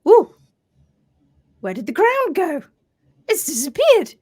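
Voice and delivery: hammed-up puzzled cell; alarmed surprise. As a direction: gasp at the lost ground. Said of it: "suddenly they go".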